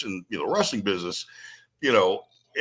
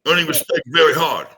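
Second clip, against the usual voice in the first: put-on louder, punchy Samoan accent in broken English; 5 semitones higher, 7 dB louder than usual. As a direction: stress it like a Samoan veteran lecturing. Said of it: "in a thick Samoan accent".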